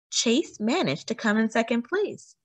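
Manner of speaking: ordinary delivery, nothing marked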